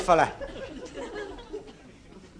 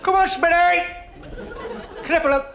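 football fan yelling; 11 semitones higher than usual. Acted. Little Scouser in the Kop urging players on, high shouting. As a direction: yell it across the stand like a rabid fan.

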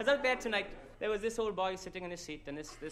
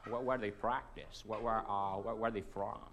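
put-on American accent; 7 semitones lower, slower than usual. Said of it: "I apologise for the American accent".

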